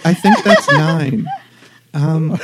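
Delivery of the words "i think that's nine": laughing through the words; slow, giggling, pitched up. Real, not acted. Laughing at joke.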